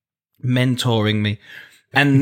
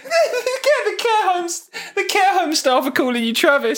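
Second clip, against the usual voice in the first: put-on very high, comic elderly voice; high old-timer through suppressed laughter; put-on care-home resident. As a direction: talk like a wobbly old person, pitched right up.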